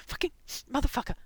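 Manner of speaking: loud, high, fast burst of swearing; panted cursing. A narrator's reading. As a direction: curse in panting bursts, straining with effort. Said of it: "the hamster panted".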